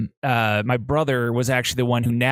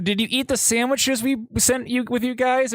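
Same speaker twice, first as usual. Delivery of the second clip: high, bright questioning tone; lightly voiced wife asking; mock wife voice; 11 semitones higher than usual. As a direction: play the worried wife lightly. Voicing the wife on phone.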